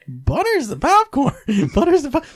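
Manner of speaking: falsetto on stressed words; squeaky high unsure voice. Real, not acted. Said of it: "my voice goes high when I don't know what I'm talking about".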